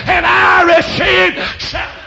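high, loud preacher's cry; triumphant shout. Real, not acted. Preacher proclaims his salvation to congregation, triumphant shout. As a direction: shout it as the sermon's climax. Climax of conversion testimony.

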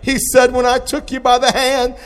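loud, high, driving sermon cry; full-force preaching. Acted. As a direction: preach it out loud to the whole church. Preaching at full force over music.

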